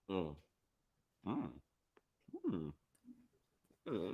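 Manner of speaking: satisfied hum while chewing; savoring mm. Real, not acted. Host tasting the sponsor's scone, humming with pleasure. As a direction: hum with pleasure over the food. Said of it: "this is from Tay Tay the Girl".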